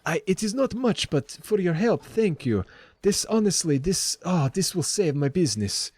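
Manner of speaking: put-on high accented voice, overcome with thanks; shopkeeper Lenine, grateful. Shopkeeper hands gold to her helpers, high accented gratitude. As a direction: stammer earnest thanks in a lilting accent.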